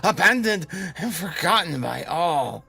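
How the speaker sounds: high loud desperate outburst; anguished shout. Acted. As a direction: shout it in despair. Villain erupts shouting.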